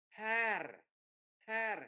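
"har" with an open "a" before r; E đọc như A. Shown as a demonstration. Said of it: "đọc chữ E như là chữ A". ("har" đọc âm A rõ)

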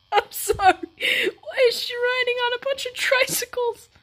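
high, cracking with helpless laughter; laughing through words. Real, not acted. Watching riders on tricycles in the video, helpless high laughter. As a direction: squeak the question out mid laugh fit.

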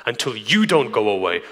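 usual speaking voice

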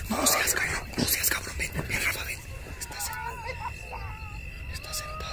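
urgent hushed whisper; whispering while hiding. Real, not acted. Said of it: "No, no puedo hablar fuerte".

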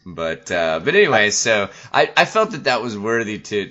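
ordinary delivery

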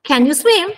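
"Can you swim?" high and loud, rising on swim; umbrella intonation. Shown as a demonstration. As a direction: lift the pitch on "swim" as a model question. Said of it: "fa formare sempre la mia forma dell'ombrello".

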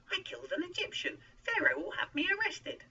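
very high, rising to loud alarm; panicky protest. A narrator's reading. Moses fears Pharaoh will arrest him, panicky protest. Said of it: "Moses protested".

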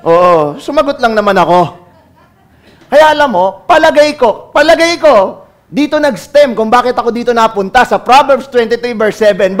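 normal talking voice

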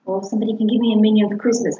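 usual speaking voice

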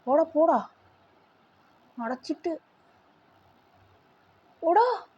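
excited shout. Real, not acted. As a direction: shout it up high to chase him off. Shooing the baby in game.

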